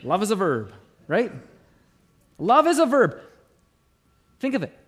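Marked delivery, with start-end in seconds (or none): 2.4-3.2 louder and higher, hammered home; emphatic. Shown as a demonstration. Preacher proclaims Love is a verb to the congregation, loud and emphatic. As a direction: proclaim it like a slogan. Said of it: "D C Talk taught us".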